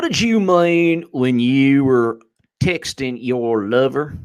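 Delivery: slow, drawn-out Southern questioning; Southern congressman. Acted. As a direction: drawl it like a Southern congressman at a hearing. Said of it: "one of the Southern congressmen's like".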